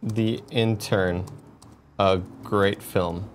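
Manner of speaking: slow, spaced announcing; slow poll read. Shown as a demonstration. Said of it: "put that on Twitter poll".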